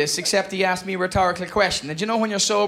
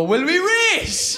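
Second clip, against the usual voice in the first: high, stretched-out call rising in pitch; cheeky challenge call; 9 semitones higher, much slower than usual. Acted. Tipsy cyclist challenging the police car behind, gleeful call. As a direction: call it out slowly, swooping up.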